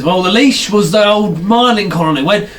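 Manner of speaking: put-on chatty old gnome, lifting in pitch as he goes; old gnome storyteller. Old gnome tells the visitor a story, rambling old-timer voice. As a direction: reminisce like an old-timer to a lad.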